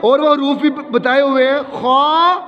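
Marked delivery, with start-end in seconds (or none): 1.8-2.4 خا thick and rounded, vowel held; emphatic خا with a long "aw"-coloured vowel; heavy letter. Shown as a demonstration. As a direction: say خا full-mouthed, as in Arabic recitation. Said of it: "موٹا پڑھنا ہے".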